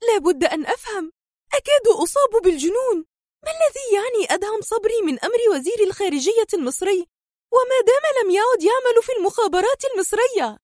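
high-pitched nervy exasperation; tense, agitated. A narrator's reading. Villainess struggles to understand his involvement, tense and agitated. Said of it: "في توتر وتقول".

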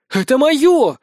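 high, loud offended cry; hurt indignation. A narrator's reading. Nelli claiming her stolen prize, hurt and indignant. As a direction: protest as if robbed. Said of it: "с обидой от Нелли".

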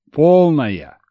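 emphatic repeated order. Acted. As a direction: slam it home as a final order. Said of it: "скомандовал".

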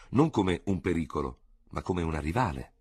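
ordinary delivery, nothing marked

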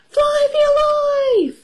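very high, sustained storybook squeal; hammed-up flee-for-it cry; panicked cry. A narrator's reading. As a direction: call the alarm out in a high pitch.

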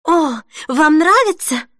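high, drawn-out delighted question; light up, stretching the question; inspired, hopeful. A narrator's reading. Denise hearing praise of her dress, thrilled. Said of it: "вдохновилась Денис".

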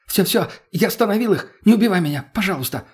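high-pitched, frightened pleading; hurried gabble. A narrator's reading. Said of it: "Быстро затараторил он".

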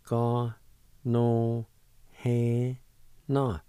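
Ka, no, he, na said slowly, one syllable at a time; syllables sounded out. Shown as a demonstration. Teacher drilling Ka, no, he, na for learners. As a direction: break it into Ka no he na. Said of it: "it's pronounced like this".

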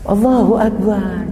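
slow stretched Allahu Akbar; drawn-out exclaim. Real, not acted. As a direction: draw out Allahu Akbar in mock wonder. Preacher exclaims slowly.

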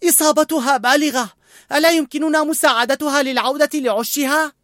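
put-on a worried boy begging; young boy voice. A narrator's reading. Boy pleads with old man to help bird.

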